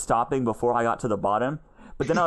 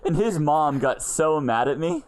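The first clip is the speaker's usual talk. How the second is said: ordinary delivery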